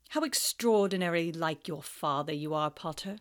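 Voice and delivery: low, cold, mocking teacher; sneering Snape. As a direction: drip with contempt.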